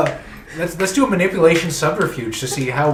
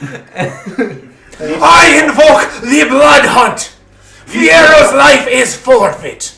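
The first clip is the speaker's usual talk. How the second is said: high, very slow, loud laughing declaration; hammed-up booming decree, drawn out; hammed-up shout; 11 semitones higher, 11 dB louder, much slower than usual. Prince declares the blood hunt again.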